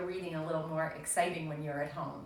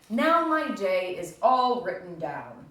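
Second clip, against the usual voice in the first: louder, slow and satisfied; pleased Toad; 8 dB louder, slower than usual. Acted. Teacher voicing Toad proud of his list, slow and louder. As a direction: savour it like a finished job.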